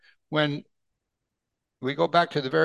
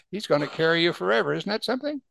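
ordinary talk, nothing marked

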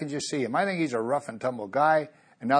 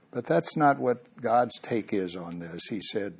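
ordinary delivery

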